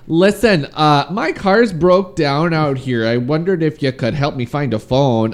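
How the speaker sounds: playing the stranded Canadian, eh; Canadian accent. Cohost plays Bundy to a woman on the beach, in a mock Canadian accent. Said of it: "That's my Canadian accent".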